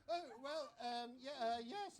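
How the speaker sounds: high, loud stammering; flustered. Acted. Lord caught out about knowing the wife, loudly flustered. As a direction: blurt out a halting cover-up.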